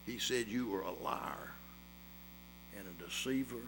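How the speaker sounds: hushed, intimate story voice; soft sermon illustration told low; quiet storytelling. A narrator's reading. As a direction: draw them into the tale softly. Quiet story of boy and snake.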